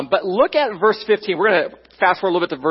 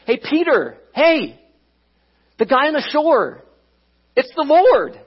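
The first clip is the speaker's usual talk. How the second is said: excited calling out; 5 semitones higher than usual. Acted. Disciple calls to Peter from the boat, growing excited. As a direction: let it build until it bursts out.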